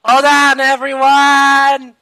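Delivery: loud, high, drawn-out shout; shouted warning. Acted. Warning everyone aboard to hold on, loud drawn-out shout. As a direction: holler it, stretching the last word. Warning passengers before wild flight.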